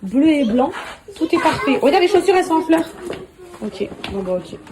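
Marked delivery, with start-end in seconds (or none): 0.0-2.9 much higher, louder, delighted enthusing; excited showing off. Real, not acted. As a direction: gush over the outfit like it's perfect. Showing child's outfit, enthusing.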